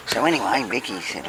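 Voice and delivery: ordinary delivery, nothing marked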